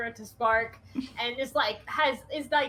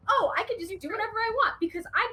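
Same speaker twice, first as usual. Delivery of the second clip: high, worked-up, pleading scolding; exasperated, upset telling-off; laying into Pip; 4 semitones higher than usual. Acted. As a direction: lecture a reckless friend out of worry, pitch jumping up. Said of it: "Eris is still laying into Pip".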